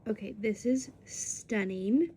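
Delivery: ordinary talk, nothing marked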